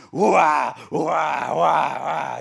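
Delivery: loud mock wah wah yelling; army bluster noise. Scared army making noise at Goliath, loud mock bluster. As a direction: bellow it like a boastful army. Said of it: "the army's going".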